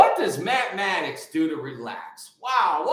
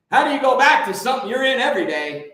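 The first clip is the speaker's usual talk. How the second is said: raised, pushing the question harder; louder insistent question; 6 semitones higher, 6 dB louder, faster than usual. Real, not acted. To his viewers, a louder insistent repeat of the question. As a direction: press the point home, louder and quicker.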